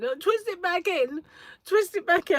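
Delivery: put-on high, loud, pleading child voice; little son begging his mum to twist the plait back in, high and anxious; worried little boy. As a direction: beg like a scared small boy. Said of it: "He was really concerned".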